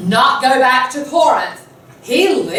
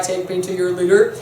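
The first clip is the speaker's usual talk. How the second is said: lower, hammed-up cliché; cheesy line; 5 semitones lower than usual. Oedipus greeting the townspeople with something stupid, cheesy. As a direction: deliver it as a knowingly corny line. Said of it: "something kind of stupid and cheesy".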